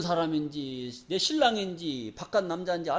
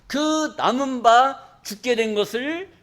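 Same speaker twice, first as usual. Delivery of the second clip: slow solemn reading; much slower than usual. Acted. Scripture read slowly.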